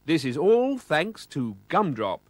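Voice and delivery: slow, lightly voiced presenter. A narrator's reading. TV presenter thanking Gumdrop, slow announcer voice. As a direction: announce it slowly like a host. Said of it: "said the presenter of the programme".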